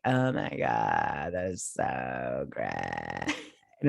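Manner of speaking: very low, slow croaking frog voice. Retold or imitated. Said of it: "frog voice the whole time".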